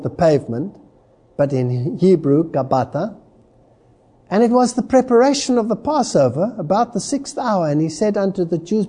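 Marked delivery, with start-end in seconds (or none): none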